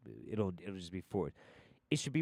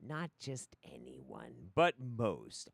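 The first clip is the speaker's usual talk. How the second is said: usual speaking voice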